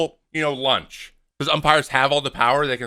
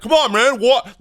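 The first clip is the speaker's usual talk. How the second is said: loud, high, goading challenge; a hammed-up imitation of an umpire picking a fight; aggressive mock confrontation; 9 semitones higher, 6 dB louder than usual. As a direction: get in his face to get a rise. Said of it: "does this super aggressively".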